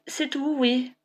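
plain reading voice

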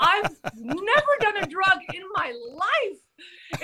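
high, stretched-out protest with laughter around; drawn-out insistence. Real, not acted. Storyteller defending herself to a teasing friend, drawn-out insistence. Insists again after listener teases her.

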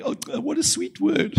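very high, drawn-out mock sobbing voice; mock tearful. Preacher imitating everybody in the church crying, mock tearful. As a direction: imitate a weepy congregation, slow and squeaky-high. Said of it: "everybody in the church is crying".

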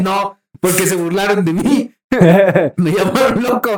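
giggling through an imitated complaint; mock whine broken by laughter; laughing mock quote. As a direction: laugh while voicing her whining. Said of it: "Va a decir".

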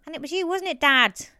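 high, rising to a loud call; talking up to Dad. A narrator's reading. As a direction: lift the voice for a deaf father on the last word. Daughter turns to deaf father.

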